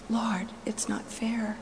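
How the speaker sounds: slow, broken whisper of grief. Retold or imitated. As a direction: breathe it out, drained and slow. Grieving prayer, repeated.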